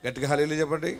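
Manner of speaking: usual speaking voice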